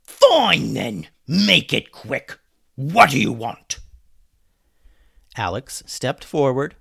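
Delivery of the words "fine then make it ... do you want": loud, higher pirate captain; angry pirate captain's demand; yelling pirate. A narrator's reading. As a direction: bellow it impatiently as a pirate. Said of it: "he yelled".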